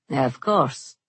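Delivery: flat. Acted. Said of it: "Merrick answered flatly".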